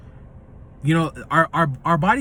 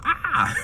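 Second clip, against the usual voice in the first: a quick pretend yelp of fright; scared yelp at a bomb blast, only hinted; mock scream; 12 semitones higher than usual. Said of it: "you're gonna".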